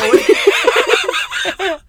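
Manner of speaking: high, loud laugh bursting out; loud burst of laughter. Real, not acted. Laughing hard at her husband's worry, loud burst of laughter. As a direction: crack up at the memory.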